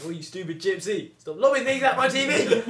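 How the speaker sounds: high, loud scolding; angry mum. Acted. Mum telling off son over the TV, hammed-up angry shout. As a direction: yell like an exasperated mother. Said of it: "my mum was like".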